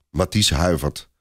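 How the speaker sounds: ordinary delivery, nothing marked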